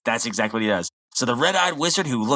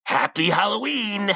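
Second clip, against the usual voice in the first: mild mock shout; 7 semitones higher than usual.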